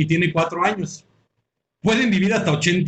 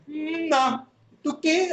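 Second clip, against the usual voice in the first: mock friend; 9 semitones higher, 4 dB quieter, much slower than usual. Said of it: "Así".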